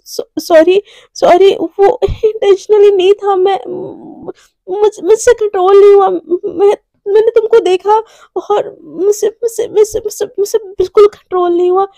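a choked, breaking plea for forgiveness; tearful apology. Acted. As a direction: voice cracking, pleading and high. Mishka tearfully apologizing after kiss.

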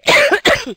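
high sharp real cough; sudden cough breaking the sentence. Real, not acted. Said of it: "sono un po' malata".

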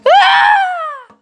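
very high, loud scream; terrified scream. Acted. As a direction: shriek in terror. Scream at the red thing.

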